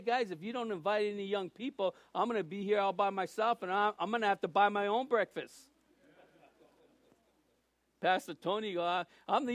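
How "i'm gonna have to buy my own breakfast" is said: ordinary delivery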